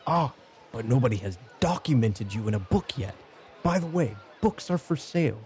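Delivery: high, eager sales-pitch voice; self-promoting monster scholar. Acted. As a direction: cheerfully plug your own book. Game master plays Volo plugging books.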